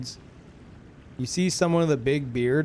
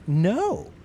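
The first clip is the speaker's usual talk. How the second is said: high sassy; 13 semitones higher than usual. Retold or imitated. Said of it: "We're like".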